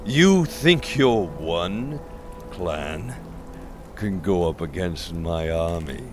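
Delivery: arrogant sneer. Acted. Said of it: "sneered, his arrogance returning".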